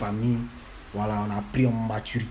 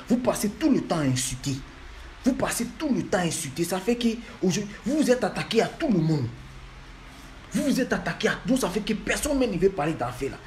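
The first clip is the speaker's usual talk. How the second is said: high-pitched, heated reproach; indignant scolding; 6 semitones higher than usual. Real, not acted. Blaming the artist's fanatic fans for insulting everyone, indignant scolding.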